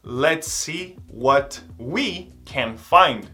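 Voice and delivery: slow, clearly spaced repeat; slow drill. Shown as a demonstration. Teacher repeating a phrase slowly for students. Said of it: "slow repeat for students".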